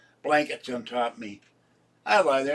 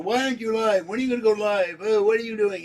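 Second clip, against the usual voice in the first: higher, louder nagging fan voice; mocking demanding viewers; 8 semitones higher, 5 dB louder, faster than usual. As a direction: mimic pestering viewers, put-on and whiny.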